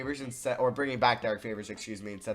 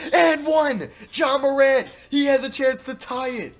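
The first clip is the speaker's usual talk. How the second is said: much higher and louder, hyped shouting; excited yell; 12 semitones higher, 11 dB louder, slower than usual. Real, not acted. Gamer celebrating his star's and-one, hyped loud yelling. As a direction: scream it like the game just turned. Player scores and is fouled.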